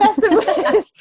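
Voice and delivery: laughing through the words; high laugh breaking into it. Real, not acted. Laughing echo of partner's phrase.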